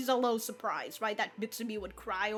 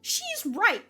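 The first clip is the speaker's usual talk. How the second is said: high-pitched, louder mocking voice of the girl; high mock quote; 12 semitones higher, 4 dB louder than usual. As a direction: squeak it out as a hammed-up girl. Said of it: "she's just like".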